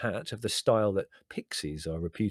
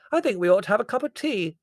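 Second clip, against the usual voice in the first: hard-of-hearing Aunt Fan; 13 semitones higher, 7 dB louder than usual. A narrator's reading. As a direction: pipe up loudly, half deaf.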